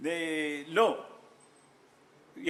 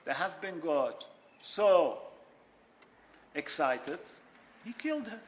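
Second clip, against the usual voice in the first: normal talking voice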